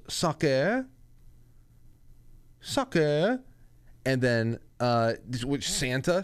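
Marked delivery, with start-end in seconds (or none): 0.1-0.9 put-on toddler's French accent, lifted at the end. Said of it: "with this French accent". 2.6-3.4 put-on toddler's French accent, lifted at the end. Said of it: "with this French accent".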